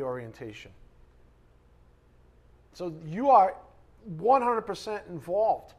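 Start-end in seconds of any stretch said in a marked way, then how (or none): 2.7-5.7 emphatic preaching. Real, not acted. Preacher driving point home.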